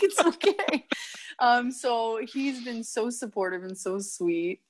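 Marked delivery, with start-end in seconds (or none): 0.0-0.9 high, sing-song mock reassurance; reassuring quote. Catching boyfriend with pizza, high sweet mock reassurance. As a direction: chirp it sweetly, much higher up. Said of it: "I was like".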